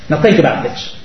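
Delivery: ordinary talk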